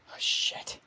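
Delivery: soft whispered curse; whispered despair. Acted. Mark whispering over his injured dad. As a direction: breathe it out, overwhelmed.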